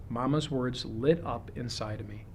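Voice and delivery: plain reading voice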